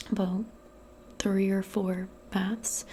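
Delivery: ordinary talk, nothing marked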